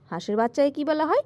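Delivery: usual speaking voice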